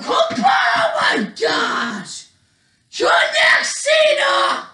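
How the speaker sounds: very high, loud squealed shout; frenzied play-by-play. Pretend. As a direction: scream it in wild excitement. Climax of mock wrestling match.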